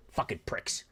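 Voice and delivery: soft hissed insult under the breath; whispered curse. Real, not acted. Host muttering at politicians after his rant, whispered curse. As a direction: spit it out barely voiced.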